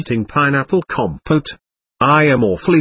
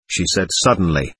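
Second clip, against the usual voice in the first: plain reading voice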